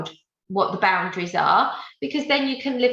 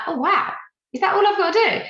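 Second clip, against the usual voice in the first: mock amazed client; 10 semitones higher, faster than usual. Trainer imitating an owner's surprise, pitched high. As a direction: act out the client's sudden relief.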